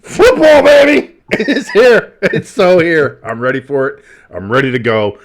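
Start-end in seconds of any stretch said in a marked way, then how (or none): 0.0-3.2 much higher, louder joyful yell; excited shout. Real, not acted. Telling Jimbo football is back, excited shout. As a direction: burst out with joy.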